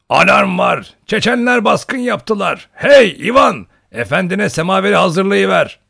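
very loud, high-pitched holler; boisterous old hunter rousing the house; booming alarm shout. A narrator's reading. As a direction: raise the alarm at full volume. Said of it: "o gür sesiyle".

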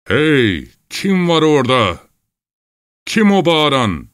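high, loud call with long vowels; ringing shout. Acted. Unseen man challenging boats from afar, ringing shout. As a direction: holler out a challenge across distance. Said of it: "Ses yeniden çınladı".

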